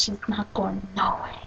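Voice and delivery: hushed menacing joke; mock sinister whisper. Joking the girl will keep her friend, sinister whisper. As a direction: whisper it creepily.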